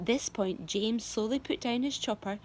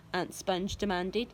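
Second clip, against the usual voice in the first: plain reading voice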